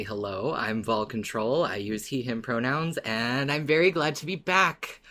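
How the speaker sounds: ordinary talk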